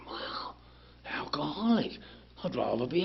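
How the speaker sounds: whisper. Acted. Realising he is an alcoholic, hushed whisper. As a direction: breathe it out in wonder.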